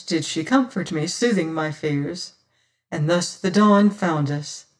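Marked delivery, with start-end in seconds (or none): none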